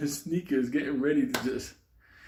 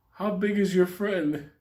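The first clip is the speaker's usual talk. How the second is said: slow, higher-pitched wary question; 6 semitones higher, slower than usual. Retold or imitated. Kid asks Paulie about his friend, slow wary question. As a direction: ask it slowly, sizing up the threat. Friend nervously sizes up the threat.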